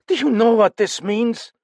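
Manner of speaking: cried out. Acted. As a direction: cry out in alarm. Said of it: "cried Chaumont".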